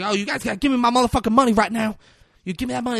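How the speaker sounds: high, fast demand for the money; yelling demand. Retold or imitated. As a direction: rant at them hurriedly. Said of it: "she runs like".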